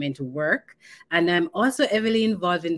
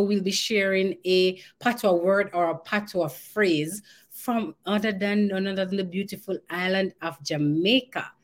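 ordinary delivery

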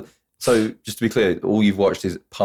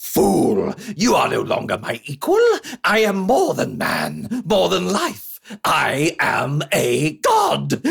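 slow, higher, god-like villain threat; booming Skeletor; 5 semitones higher, much slower than usual. Pretend. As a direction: gloat like a cartoon overlord. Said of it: "That was very, forgive the pun, animated of you".

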